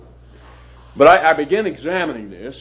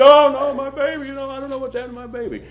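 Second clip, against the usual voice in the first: panicked wailing; 11 semitones higher than usual. Retold or imitated. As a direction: imitate the mother-to-be refusing help in panic. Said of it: "screaming and yelling".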